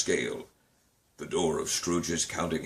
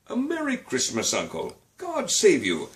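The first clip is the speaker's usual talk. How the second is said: cheerful cry; 10 semitones higher than usual. A narrator's reading. Nephew greets his uncle again, cheerful and high. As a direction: call out a plain happy Christmas greeting. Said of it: "cried a cheerful voice".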